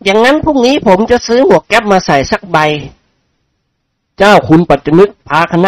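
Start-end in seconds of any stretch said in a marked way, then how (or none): 0.0-2.6 cocky reply. A narrator's reading. Thug replies pleased.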